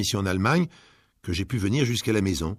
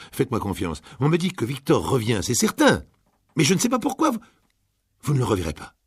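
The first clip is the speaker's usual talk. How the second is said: higher and louder, building to a near cry; excited fortune teller; 5 semitones higher, 5 dB louder than usual. Acted. Fortune teller telling Gabriel her son returns, excited. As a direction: let the vision rise in pitch.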